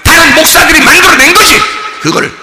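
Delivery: forceful pulpit delivery; full-force preaching. Real, not acted. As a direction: hammer each item at the same high pitch.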